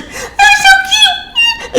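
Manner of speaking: very high, slow, drawn-out squeal with laughter; excited squeal. Real, not acted. Fan gushing over the toy designs, squealing. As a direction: melt over how cute they are.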